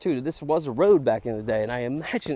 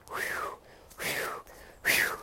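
soft exhales; 7 dB quieter, much slower than usual. Real, not acted. Catching breath after fright.

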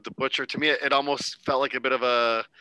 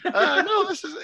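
squeaky falsetto phone voice, much higher and louder; 4 dB louder than usual. Retold or imitated. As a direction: chirp it up high like a goofy phone call, laughing through it. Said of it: "[name] does Eat Your Heart Out".

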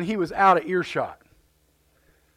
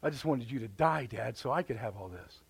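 muttered hidden thought; 8 semitones lower, 9 dB quieter than usual. Retold or imitated. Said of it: "That's what was in his heart".

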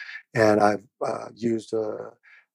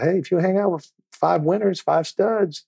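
higher dad lecture voice; light fatherly advice voice; lecturing dad; 8 semitones higher than usual. Retold or imitated. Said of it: "You know, I said".

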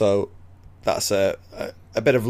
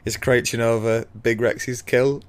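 usual speaking voice

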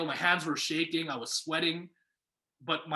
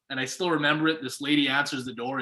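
usual speaking voice

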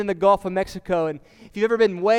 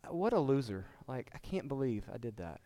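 muttered self-criticism; 6 semitones lower, 10 dB quieter than usual. Retold or imitated. Voicing harsh inner self-talk.

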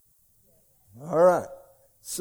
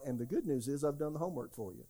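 ordinary delivery

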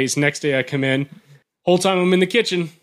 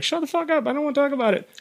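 agitated refusal; 10 semitones higher, 5 dB quieter than usual. Retold or imitated. Buddy refusing to discuss who is haunting them, agitated. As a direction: imitate a friend shutting the subject down, pitched way up.

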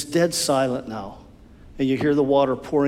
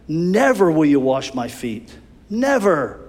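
Never hit hard with falling end; emphatic refusal. Acted. Peter refusing Jesus, hard stressed refusal. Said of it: "Peter comes back".

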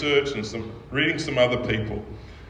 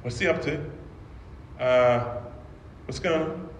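puzzled angels; 7 dB quieter than usual. Retold or imitated. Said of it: "all the angels are going".

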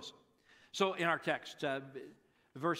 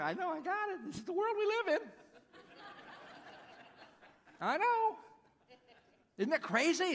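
amused agreement; 11 semitones higher than usual. Acted.

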